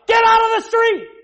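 put-on high, loud shout at a child; yell. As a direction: yell to stop your son running into traffic. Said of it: "I'm gonna yell".

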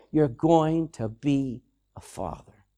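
slow, low pronouncement; measured, lightly voiced voice of God; God speaking. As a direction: deliver a promise slowly and low, word by word. God's promise voiced to Abraham.

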